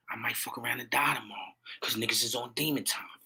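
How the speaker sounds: hushed whisper of a grim warning. Real, not acted. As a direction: lean in and whisper it. Grim aside to viewers.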